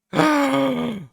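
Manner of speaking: sharp high surprised gasp. Real, not acted. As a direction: gasp in shock. Surprised at the news.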